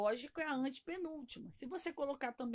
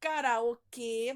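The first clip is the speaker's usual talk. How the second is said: Karaokê, slow, with a loud final kê; stress on last syllable; 7 dB louder, much slower than usual. Shown as a demonstration. Teacher helping pupils find the strong syllable, Karaokê stressed on kê. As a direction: stretch and punch the kê in Karaokê. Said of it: "você vai sublinhar o que".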